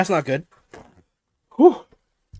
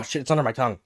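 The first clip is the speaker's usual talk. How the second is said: ordinary delivery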